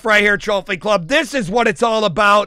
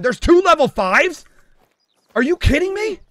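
high, stretched disbelief; astonished; 7 semitones higher than usual. Real, not acted. Streamer stunned at two level fives, astonished. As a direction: let the pitch climb in amazement.

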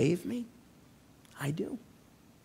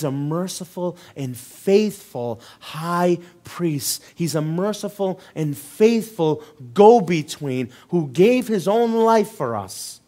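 slow preaching; 2 semitones higher, 7 dB louder, slower than usual. Real, not acted.

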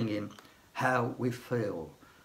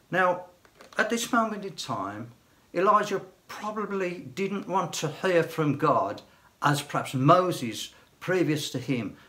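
ordinary delivery

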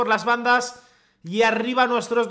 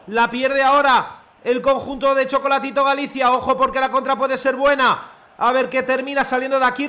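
steady live match narration; measured broadcast commentary; play-by-play commentary; 3 semitones higher, 4 dB louder than usual. Acted. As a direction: narrate the buildup like a TV caster. Self-commentary as play-by-play caster.

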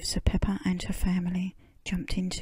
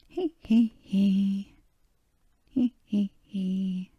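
giggling; slower than usual. Acted. Piglet giggling.

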